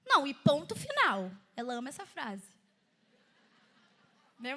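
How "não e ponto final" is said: louder, final-word mum imitation; imitating mother. Imitating her mother's favourite phrase to the congregation, emphatic and loud. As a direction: lay down the law like mum. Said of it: "a minha mãe sempre gostou de falar que".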